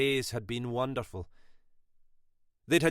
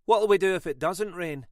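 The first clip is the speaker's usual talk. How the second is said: higher, louder curt retort; terse reply; 8 semitones higher, 7 dB louder than usual. A narrator's reading. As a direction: throw the question back curtly. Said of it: "Her reply was terse".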